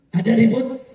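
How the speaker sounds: high, insistent call-and-response; rallying crowd questions. Real, not acted. Preacher asking the crowd about the rally, insistent. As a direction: throw each question out for a roar back.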